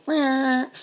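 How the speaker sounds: a loud, high, mocking Rah call; loud mock cry; mock Rah yell. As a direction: bark it out like a passing cheer. Mocking Red Cross drive-bys.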